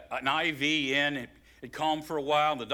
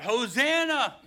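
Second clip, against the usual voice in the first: high, loud jubilant shout; crowd shouting hosanna; 10 semitones higher, 4 dB louder than usual. Acted. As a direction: cry it out like a cheering crowd. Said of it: "and they're shouting out".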